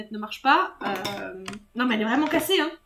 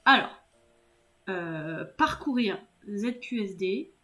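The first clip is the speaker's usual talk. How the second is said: ordinary talk, nothing marked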